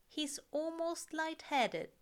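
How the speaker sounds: ordinary delivery, nothing marked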